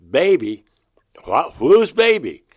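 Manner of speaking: retelling a shocked cry of disbelief. Retold or imitated. Hearing his baby is dying, he blurts out in startled disbelief.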